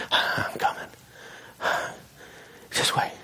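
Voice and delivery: voiceless puffing between short phrases; imitating out of breath. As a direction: gasp the reply as if badly winded. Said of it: "he's out of breath".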